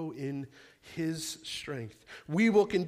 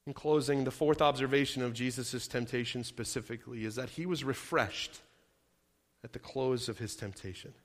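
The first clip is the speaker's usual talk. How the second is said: ordinary delivery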